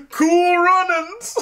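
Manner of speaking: high, loud, drawn-out cheer; triumphant shout. Acted. Host crowing the movie title, loud and slow. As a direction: belt it out like a victory cry.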